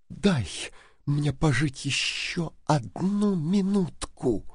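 soft, slow begging; near-whispered plea. A narrator's reading. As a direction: beg softly, with hardly any force. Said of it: "прошептала она". (тихая мольба полушёпотом)

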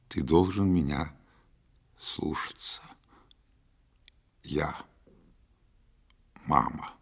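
trailing down into a whisper; fading insistence. A narrator's reading. Mother losing heart before her son, fading to whisper.

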